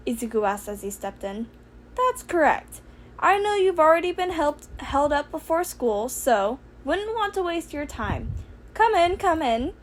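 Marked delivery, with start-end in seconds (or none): 1.9-9.7 high, chirpy little principal voice; Nezu's voice. Acted. Principal welcoming student, high and chirpy. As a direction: lift the voice up small and polite.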